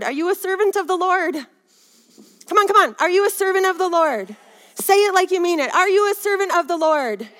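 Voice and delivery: high, insistent preacher's challenge; rally the congregation to answer; rousing call. Real, not acted.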